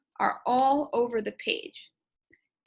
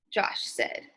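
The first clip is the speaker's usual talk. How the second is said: ordinary talk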